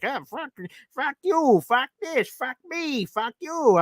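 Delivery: high, hammed-up angry swearing; mock cursing. Frustrated fan imitated with high, hammed-up cursing. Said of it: "you're like".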